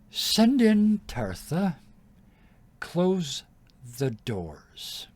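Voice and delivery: slow, high, loud call from afar; distant summons. Acted. Queen calls the next prisoner in, distant command. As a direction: summon them coldly, drawn out.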